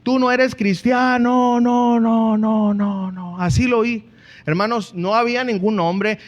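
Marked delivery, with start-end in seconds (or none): 0.0-3.4 inner voice echoing. Retold or imitated. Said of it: "yo oí esto".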